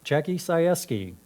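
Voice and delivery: normal talking voice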